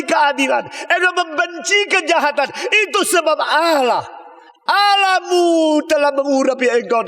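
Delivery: high, loud, slow proclamation to Christ; declaiming scripture. Acted. Preacher proclaiming the psalm to Christ, loud ringing declamation. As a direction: ring the verse out like a herald.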